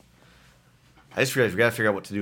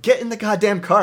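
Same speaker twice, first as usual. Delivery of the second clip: loud, high burst of impatience; exasperated order; 11 semitones higher, 7 dB louder than usual. Retold or imitated. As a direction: act out losing patience with a fussy passenger. Retold snapping at a complaining passenger.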